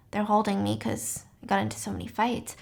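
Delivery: hushed, breathy whisper of a confined patient. Retold or imitated. Sawyer whispering to David that she's held for fights. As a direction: drop to a whisper for the line.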